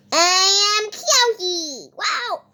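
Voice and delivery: slow, odd made-up puppet voice; weird put-on voice. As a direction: say it like a strange new creature introducing itself. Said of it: "Why did I talk like that?".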